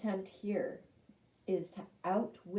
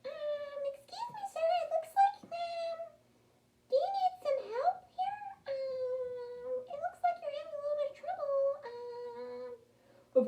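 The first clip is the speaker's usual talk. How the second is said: timid little mouse. Acted. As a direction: squeak politely at the trapped lion. Mouse voiced in story.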